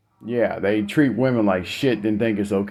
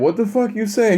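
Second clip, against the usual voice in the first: imitating an affronted mother, high-pitched; high-pitched mock outrage; indignant challenge; 10 semitones higher than usual. Mother answering son who wants his card, indignant.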